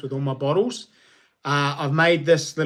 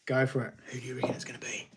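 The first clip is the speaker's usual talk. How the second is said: soft whisper off-mic; 3 semitones lower, 10 dB quieter, much faster than usual. Real, not acted. Streamer whispers to his son while viewers guess. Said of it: "Did you hear him whispering them?".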